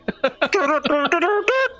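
imitating a goofy background laugh; mock giggle. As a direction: mimic a silly giggle. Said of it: "you're in the back being like".

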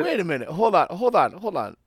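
high, loud hold on; interrupting. Real, not acted. Stopping his friend to correct him about England, loud and high. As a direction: cut him off, keen to correct him.

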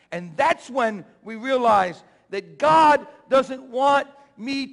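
loud, high, rising preacher's cry; preaching at full force. Acted. Pastor preaching to the congregation, loud and climbing.

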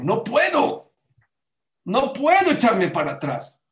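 loud, high-pitched protest; Korach. Acted. Korach sees he is wrong but won't retract, loud protest. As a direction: cry out, refusing to back down. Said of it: "Pero dijo".

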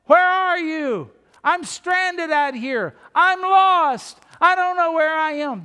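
high, slow, drawn-out shouts; cry for help. Acted. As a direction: call out like someone stranded and lost. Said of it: "You think of somebody going".

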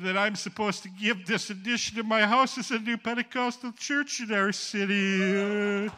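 high, strained mock-weeping; imitated tearful crying. Weeping mayor giving his house for a church, imitated crying. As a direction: wail it out, stretching the ending. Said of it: "he starts to cry".

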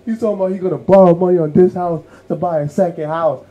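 high, loud indignant complaint; mock outrage at a wasteful husband; upset mom. Mom complaining about dad's second house, indignant and loud. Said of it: "she was upset".

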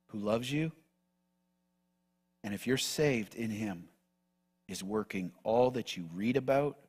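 gentle guided prayer voice with whispered words; hushed pastoral meditation. Acted. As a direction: ease the church into rest, almost whispering. Quiet reflective call to rest.

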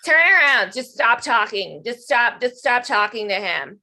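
slow, insistent mock scolding, loud opening; annoying girlfriend. Girlfriend ordering players to stop talking to him, annoying imitation. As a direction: imitate an annoying girlfriend, hit "Turn around" loud, then drag it out. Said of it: "exactly how she sounded".

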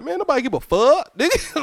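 high, exasperated mock dismissal. Everybody waving off an excuse, mock exasperation. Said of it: "everybody like".

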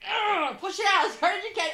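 straining hard, played for laughs; mock straining. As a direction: push the words out with exaggerated effort. Mimes straining on the toilet.

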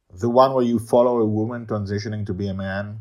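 accusing. A narrator's reading. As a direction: needle him with the question. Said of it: "with an accusing tone".

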